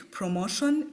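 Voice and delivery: ordinary talk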